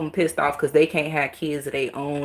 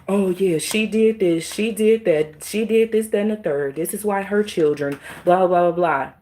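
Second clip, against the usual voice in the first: lightly mocking caseworker voice, higher; mocking accusers; 3 semitones higher than usual. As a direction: parrot the accusers with scorn. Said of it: "keep trying to say".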